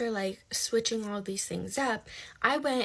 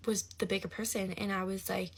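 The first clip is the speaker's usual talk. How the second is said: usual speaking voice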